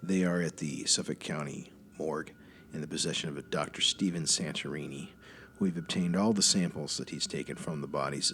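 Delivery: low, quiet official phone voice; formal agent on phone. Acted. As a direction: speak low like a by-the-book federal agent.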